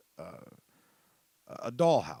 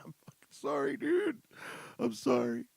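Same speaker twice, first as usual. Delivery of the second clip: mock squeaky apology; 3 dB louder than usual. Retold apology to angry caller.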